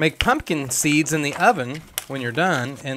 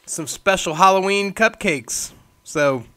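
ordinary talk, nothing marked

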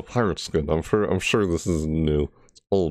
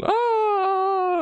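long, very high-pitched scream; imitated scream. Gamer copies the screaming character in the trailer, imitated scream. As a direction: scream out one held note, mimicking. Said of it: "He's, like, screaming".

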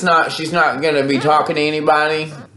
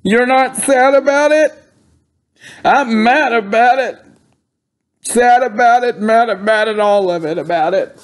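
raised-pitch mock distress; put-on upset; 5 semitones higher than usual. Caller confronting Willie about a death, put-on upset. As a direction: act upset and indignant on the phone.

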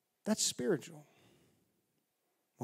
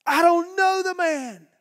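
high, loud angry oath; furious swearing; 10 dB louder than usual. Acted. Peter swearing to the bystanders, angry shout. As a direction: spit the denial out in a rage. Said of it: "blew a gasket".

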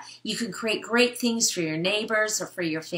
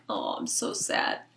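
whispered low mope; whispered mock sadness; sad dog; 13 semitones lower, 4 dB quieter than usual. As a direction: sigh it out like a sulking dog. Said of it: "he's just like".

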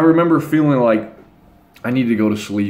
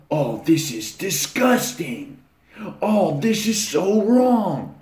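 slow, higher whiny mock disgust; mocking outraged guy; 7 semitones higher, much slower than usual. Mocking social justice guys: slow whiny mock outrage. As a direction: ham up the offended justice warrior. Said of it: "they're so emotional".

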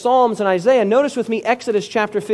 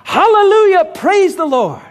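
loud, high burst of praise; joyful shout; 7 semitones higher, 4 dB louder than usual. Retold or imitated. New convert shouting praise over the Red Sea, loud and joyful. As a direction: shout it out in sudden joy. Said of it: "extemporaneously shouted".

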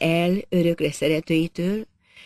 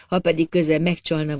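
plain reading voice

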